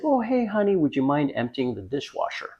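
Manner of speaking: light, soft, high-starting polite request; imitated wife. Wife leaving for work asks a favour, imitated politely. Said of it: "she's like".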